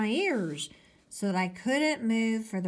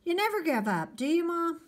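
teasing; 10 semitones higher, faster than usual. Acted. Teasing mother.

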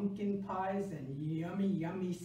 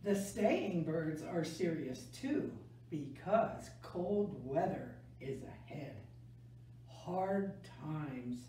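gentle picture-book read-aloud; reading to children. A narrator's reading. Teacher reading a November book to kids, gentle storytelling.

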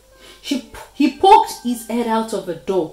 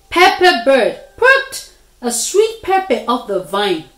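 ordinary delivery, nothing marked

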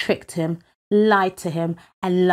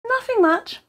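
high, teasing brush-off; feigned nonchalance, pitched up; coy. Acted. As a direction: act innocent in a high little voice. Boy coyly hides the secret.

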